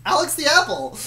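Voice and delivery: loud, high burst of recognition; a happy shout of recognition; excited recognition. Real, not acted. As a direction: blurt the name out in delight.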